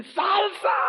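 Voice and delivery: high-pitched mock shout; grito imitado. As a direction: blurt it out high, like an accidental cry in church. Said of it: "¿Qué crees que grité?". (grito agudo imitado)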